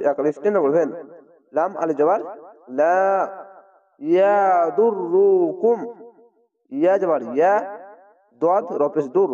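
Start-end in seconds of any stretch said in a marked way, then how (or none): 4.0-5.8 very slow ইয়াদুররুকুম with doubled র; recited slowly. Shown as a demonstration. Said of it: "slow Quran recitation demo". (ধীরে ইয়াদুররুকুম)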